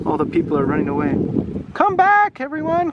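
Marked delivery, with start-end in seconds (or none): 1.7-2.9 high calling-out voice; crying out. Acted. Children calling to fleeing villagers, crying out. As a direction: call after them, pitched way up. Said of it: "cried the others".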